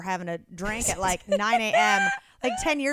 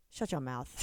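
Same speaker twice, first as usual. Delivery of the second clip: quiet retold rude caller; 9 semitones lower, 10 dB quieter than usual. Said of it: "I got "shut your mouth".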